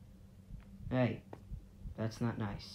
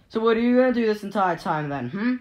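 loud, raised confronting question; challenging; 5 semitones higher, 7 dB louder than usual. Pretend. Player confronts the lingering stalker, loud challenge. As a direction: demand an answer from the intruder.

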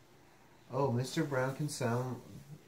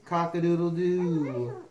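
higher, louder, drawn-out crow; rooster crow; 4 semitones higher, 6 dB louder, slower than usual. Acted. Reader mimics a rooster for the listener, crowing. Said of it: "He can sound like a rooster".

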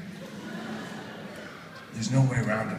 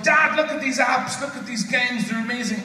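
higher, eager mock kid voice; excited young son; 7 semitones higher than usual. Son showing dad his games, excited high voice. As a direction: imitate a child thrilled by a new find. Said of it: "he comes home and he goes".

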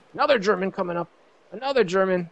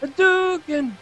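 Hadouken shout; 14 semitones higher, 4 dB louder, slower than usual. Pretend. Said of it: "That's my Japanese victory".

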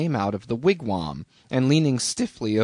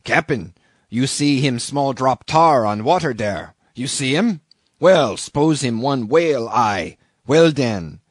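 put-on louder, broken-English islander accent; harpooner's broken English; 5 dB louder than usual. A narrator's reading. Harpooner showing off his aim to the captain, broken-English accent. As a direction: call out in pidgin-accented English. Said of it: "cried out in some such way as this".

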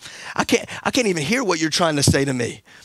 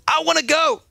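put-on high pleading child's voice; Glory begging to go to the store; little girl begging; 11 semitones higher than usual. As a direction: whine like an eager small daughter. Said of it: "Glory always says".

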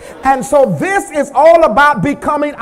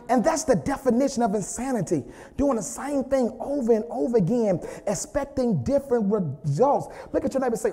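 much softer, low and intimate; quiet sermon aside; 3 semitones lower, 7 dB quieter than usual. Acted. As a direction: draw them in close. Quiet conversational preaching.

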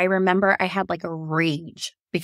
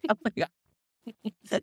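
stunned; 7 dB quieter, much slower than usual. Retold or imitated. Stunned after positive test.

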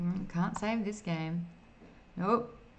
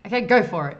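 loud go-ahead; 12 dB louder, faster than usual. Acted. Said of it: "you tell the barber".

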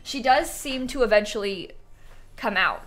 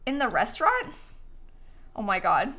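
ordinary talk, nothing marked

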